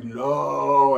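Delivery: drawn-out low slow-motion no. Retold or imitated. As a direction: stretch the word like a movie in slow motion. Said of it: "He was like, It was slow motion".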